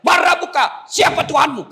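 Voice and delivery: high, loud shout; thundering interrogation. Acted. Angel questioning the dead in the grave, thundering shout. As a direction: demand answers like a stern grave angel.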